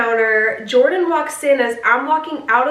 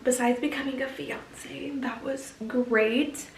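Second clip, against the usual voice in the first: whispered aside; 9 dB quieter than usual. Real, not acted. Aside about becoming a fiance.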